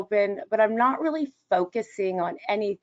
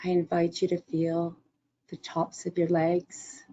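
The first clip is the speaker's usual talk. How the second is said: guided meditation; 4 semitones lower than usual. Acted. Leads a body-scan meditation.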